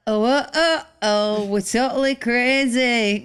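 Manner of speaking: playful mock British accent; mock English accent. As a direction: parody a British pop singer. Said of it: "It'd be awful".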